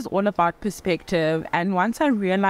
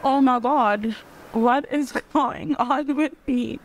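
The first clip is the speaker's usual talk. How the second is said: fighting back tears mid-sentence, then apologizing; getting emotional; 3 semitones higher than usual. Real, not acted. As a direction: voice choking up with real tears, pitch lifting. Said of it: "I'm getting so emotional".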